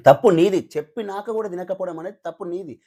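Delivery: loud blaming తప్పు నీది with a hard falling end; emphatic accusation. Real, not acted. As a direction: slam తప్పు నీది down like a verdict. Angry rant at contestant.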